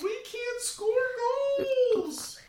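squeaky high cartoon voice; silly high voice. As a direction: play a squeaky little team mascot. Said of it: "that stupid voice".

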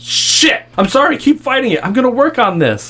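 high, loud frustrated yell. Acted. Woman apologizing as her gun fails, frustrated yell. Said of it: "and she just yells".

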